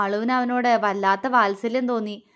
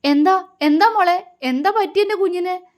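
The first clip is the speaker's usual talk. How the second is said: high, louder alarmed questions; frightened; 7 semitones higher, 3 dB louder than usual. A narrator's reading. Mother Devika alarmed at her son's mark, frightened. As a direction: ask in sudden panic for her child. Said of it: "Devika പേടിയോടെ ചോദിച്ചു".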